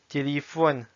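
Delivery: "Téléphone" as Russian "tyelyefon"; Russian pronunciation. Shown as a demonstration. Teacher reading words with learners, "Téléphone" in Russian. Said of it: "s'entraîner à lire les mots".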